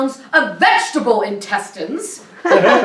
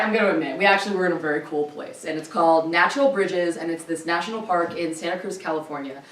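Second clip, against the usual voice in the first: ordinary talk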